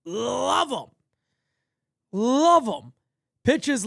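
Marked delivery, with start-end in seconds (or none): 0.0-0.9 put-on voice. Repeated in a higher put-on voice. 2.1-2.9 louder love 'em. Pretend. Third take, louder.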